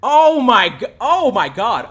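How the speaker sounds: loud, high, hammed-up gasp of shock; mock shock. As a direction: overplay being caught out.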